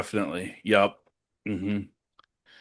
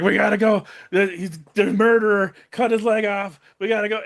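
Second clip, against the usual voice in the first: higher, louder mock-frantic urging; retold panic; 6 semitones higher, 4 dB louder than usual. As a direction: act out a panicked plea to flee. Retelling a panicked escape plea.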